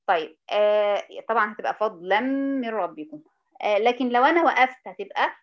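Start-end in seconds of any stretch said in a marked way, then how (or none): 1.7-3.2 "فضلًا من ربكم" run together as fadlam-mir-rabbikum; joined reading. Shown as a demonstration. Teacher linking words, "فضلًا من ربكم" joined with merged endings. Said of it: "أنطقها وأوصل بيها الكلام".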